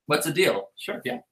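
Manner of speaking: soft, offhand casual reply; nonchalant. Retold or imitated. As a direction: toss it off quietly, no big deal. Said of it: "super, like, nonchalantly".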